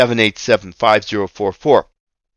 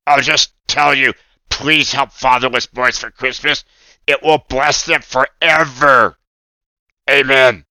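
lightly voiced pleading puppet; a hint of a cartoon puppet asking for help; earnest puppet appeal; Oscar puppet voice.

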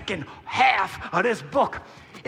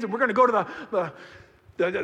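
ordinary talk

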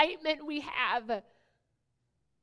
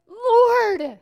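much higher, loud desperate call; crying out in desperation; 8 semitones higher, 12 dB louder than usual. Acted. Disciples in the sinking boat crying out desperately to the Lord. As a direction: call for help as if drowning. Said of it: "crying out in desperation".

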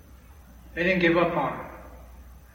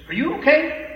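concerned lady; 11 semitones higher, 4 dB louder than usual. Retold or imitated. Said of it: "and she says".

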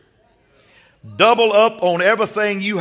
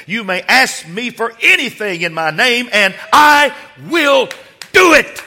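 preaching building to a shout; 5 semitones higher than usual. Acted. Preacher declaring Jesus' promise to the house, building to a shout.

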